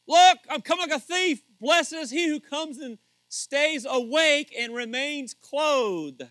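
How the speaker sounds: loud, high scripture proclamation; full-force preaching. Acted. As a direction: proclaim the verse to the back row.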